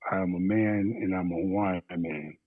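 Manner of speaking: ordinary talk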